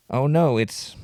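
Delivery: ordinary delivery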